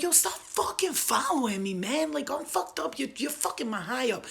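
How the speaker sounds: high-pitched, whiny telling-off; annoyed protest. Retold or imitated. Drunk woman telling two followers to back off, irritated protest.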